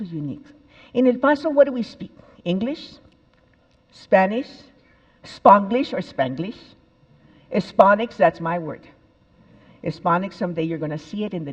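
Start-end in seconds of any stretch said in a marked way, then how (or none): 0.9-6.6 calling out. Real, not acted. Lively call to audience.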